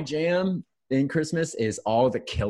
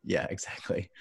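ordinary talk

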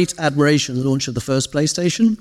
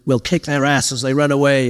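a lightly put-on American accent, brash; mock American exec boast; American accent, half-hearted impression. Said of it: "I don't know why I did that accent".

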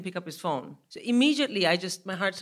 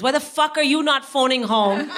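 loud, high re-enacted telling-off; hammed-up angry demand; exasperated scolding; 6 semitones higher, 7 dB louder than usual. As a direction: snap it as an outraged parent. Re-enacted mother scolding son by phone.

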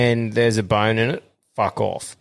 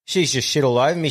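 ordinary talk, nothing marked

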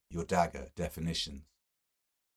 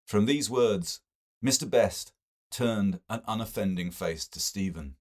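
ordinary delivery

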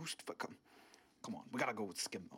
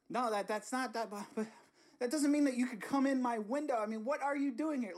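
higher, louder indignation; indignant; 10 semitones higher, 4 dB louder than usual. Acted. Host scolding intruder, higher and indignant. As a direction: protest in exasperation.

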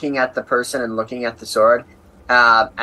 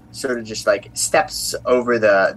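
normal talking voice

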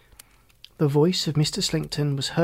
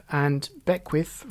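ordinary delivery, nothing marked

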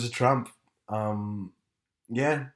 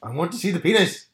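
mock complaint; 7 semitones higher, 6 dB louder than usual. Mock whining about blocked view.

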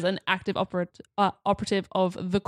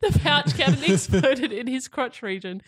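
higher, breaking up with real laughter; laughing; 4 semitones higher than usual. Real, not acted. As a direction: struggle through the sentence while laughing. Cracking up at the absurd article.

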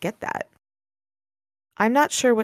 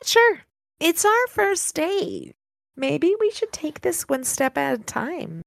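story narration; 6 semitones higher than usual. A narrator's reading. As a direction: read the passage like a novel narrator. Reads the novel aloud.

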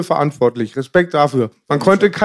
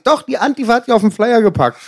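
higher-pitched mother's voice on the phone; imitating his mother; 7 semitones higher than usual. Son retelling his mother's phone call, in a higher mother voice. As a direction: play the mom calling, pitched up high. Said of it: "rief mich meine Mutter an".

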